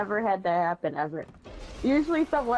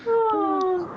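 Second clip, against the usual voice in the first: laughing; 10 semitones higher, much slower than usual. Real, not acted. Cracking up reading raid report.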